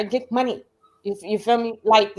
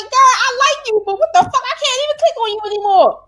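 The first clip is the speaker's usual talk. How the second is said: high, loud mock exasperation at a girlfriend; exasperated girlfriend; 11 semitones higher, 4 dB louder than usual. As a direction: pitch it way up, like a fed-up friend. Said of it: "I was sitting here like".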